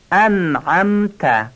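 انعمت slow, glottal stop first, then ayn; careful Arabic. Shown as a demonstration. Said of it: "اول الف همزه است، دوم عینه". (انعمت آهسته، اول همزه بعد عین)

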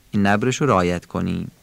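usual speaking voice

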